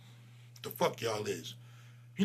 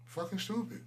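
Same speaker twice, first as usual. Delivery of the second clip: softer, half to himself, rising end; a quieter disgusted repeat; muttered insult; 5 semitones higher, 9 dB quieter than usual. Acted. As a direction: mutter it under your breath.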